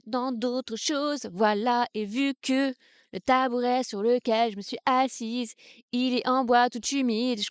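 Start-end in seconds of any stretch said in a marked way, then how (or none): none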